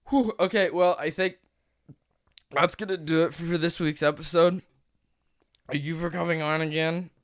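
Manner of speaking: upbeat host sign-off; podcast outro. Acted. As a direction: wrap up the show brightly. Episode outro.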